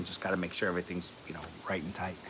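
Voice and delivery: unvoiced fast whisper. Shown as a demonstration. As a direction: breathe it out fast, no voice, like a secret. Whispered aside before repeating loud.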